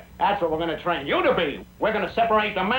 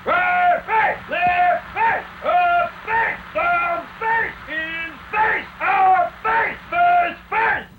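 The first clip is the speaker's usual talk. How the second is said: sergeant calling facing drill; barked drill commands; much slower than usual. Acted. Sergeant drills soldiers in the face game, barked commands. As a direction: punch out each command in a steady beat.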